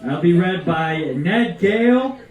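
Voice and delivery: ordinary delivery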